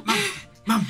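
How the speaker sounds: whisper yelling. Retold or imitated. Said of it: "I'm gonna whisper shout it".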